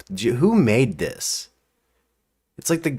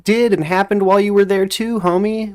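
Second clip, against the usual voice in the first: mocking voice; 11 semitones higher than usual. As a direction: taunt him in a put-on high voice. Mocking Trump directly.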